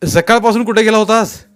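loud, high-pitched demand; harsh interrogation. A narrator's reading. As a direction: demand an answer from the suspect. Policeman interrogates the suspect.

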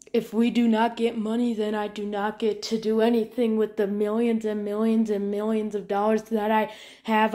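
usual speaking voice

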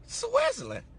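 very high, falling cry of disbelief; incredulous echo. Retold or imitated. Man mocking someone's claim to Swiss family roots, incredulous. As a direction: echo the place name way up high, appalled.

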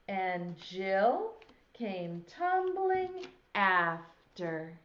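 slow, lilting rhyme recitation; reciting a nursery rhyme. Shown as a demonstration. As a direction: recite it slowly for little kids. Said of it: "Can you say it with me".

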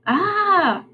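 a short high pained cry, not loud; muffled scream. A narrator's reading. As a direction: yelp high as if muffled by a blanket. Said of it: "Henry's scream wasn't very loud".

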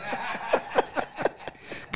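risa aireada sin voz; ríete casi sin sonido; risa ahogada. Real, not acted. (risa soplada y silenciosa)

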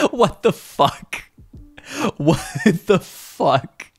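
high, drawn-out disbelief; real shock. Real, not acted. Streamer reacting to a brutal message, shocked disbelief. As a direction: stretch it out in high-pitched shock.

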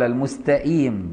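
المستقيم with a glottal stop for ق; hamza for qaf. Shown as a demonstration. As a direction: say المستقيم as 'mustaʔim'. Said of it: "بالهمزة بدل القاف".

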